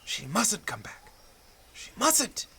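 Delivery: hushed, high-pitched desperate wish; anxious dread. Acted. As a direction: plead softly to yourself, pitch climbing. Husband waits, dreading her return.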